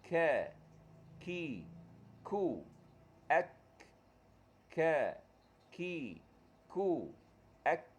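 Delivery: "Ka, ki, ku" dengan hembusan angin; kaf berangin. Shown as a demonstration. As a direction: lepaskan angin pada kaf. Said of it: "huruf kaf ada angin". (kaf dengan hembusan)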